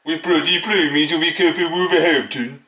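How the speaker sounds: mock the locals in a poor Wolverhampton accent. United fan mocking Wolves supporters before Monday's clash, in a bad Wolverhampton accent. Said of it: "That's a Wolverhampton accent there".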